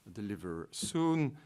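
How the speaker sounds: normal talking voice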